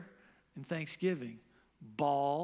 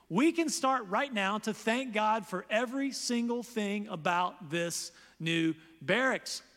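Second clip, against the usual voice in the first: ordinary delivery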